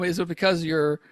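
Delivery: ordinary talk, nothing marked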